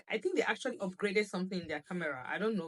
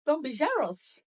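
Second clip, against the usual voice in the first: laughing tease; 7 semitones higher, 5 dB louder than usual. Real, not acted. Teasing husband laughing.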